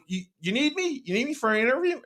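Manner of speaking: high, mock-eager offer; teasing. Player teasing Kyle about being interviewed, high mock-eager. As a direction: needle him by pretending to be wanted. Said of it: "he knew I was messing with him".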